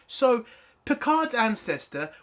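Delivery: ordinary talk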